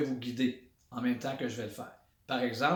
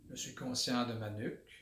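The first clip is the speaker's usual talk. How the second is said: guided body scan; 3 semitones lower, 6 dB quieter than usual. Shown as a demonstration. Instructor leading listeners through a body awareness exercise, calm meditation voice. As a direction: guide them inward quietly, one body part at a time.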